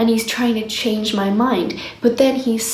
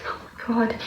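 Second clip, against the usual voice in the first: exasperated hush; 9 dB quieter than usual. Retold or imitated. Disbelief at partner's remark.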